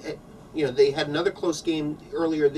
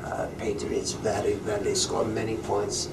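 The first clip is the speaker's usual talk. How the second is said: put-on broken English in a thick Russian accent; lightly comic Russian accent; 3 semitones lower, 4 dB louder than usual. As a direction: play a Russian goalie talking football. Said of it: "this Russian accent".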